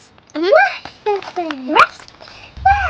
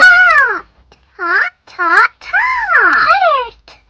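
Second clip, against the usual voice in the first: excited shouting; 8 semitones higher, much slower than usual. Real, not acted. Excited child shouting a word.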